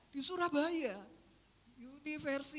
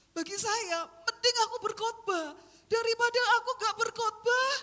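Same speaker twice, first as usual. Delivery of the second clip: loud, higher preaching cry; fervent declaration; 5 semitones higher, 10 dB louder than usual. Real, not acted. Preacher to her church, declaring loudly. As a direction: proclaim it with full force.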